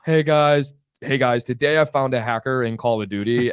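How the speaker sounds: put-on American gamer-video voice; American accent. Young gamer greeting viewers in his videos, put-on American accent. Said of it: "American accent".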